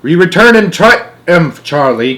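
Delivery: loud, slow triumphant proclamation; triumphant arrival cry. A narrator's reading. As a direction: announce the return like a victory. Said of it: "cried Mister Wonka".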